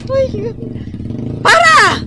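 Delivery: yelling for the tricycle to stop; loud sharp call to halt; shouting para. Acted. Passenger yelling at the driver to stop, loud.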